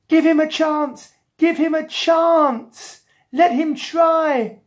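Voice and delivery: high, loud, drawn-out plea; desperate lady. Acted. Lady on her knees pleading for Jack, desperate. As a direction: wail the demand, stretching the last words. Screaming lady begs the policeman.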